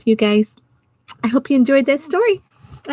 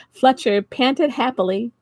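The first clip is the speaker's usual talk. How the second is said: plain reading voice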